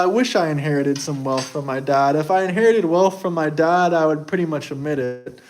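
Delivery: ordinary talk, nothing marked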